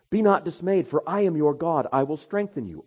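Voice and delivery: full-force sermon delivery; preach. Acted. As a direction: preach it out to the congregation.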